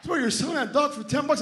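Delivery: high-pitched, disbelieving question; can't believe it, voice way up; incredulous. Acted. Buyer hears talking dog costs ten bucks, incredulous. Guy incredulous at low price.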